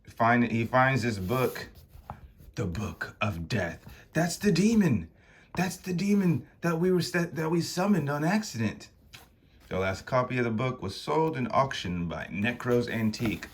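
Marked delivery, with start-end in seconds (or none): 4.1-8.9 higher-pitched anxious realisation; alarmed Konstantin. Acted. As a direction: blurt it out in alarm. Konstantin recognises the demon.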